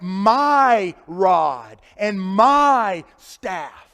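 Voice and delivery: emphatic pulpit reading; slow weighted scripture. Shown as a demonstration. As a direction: lay down each word heavily.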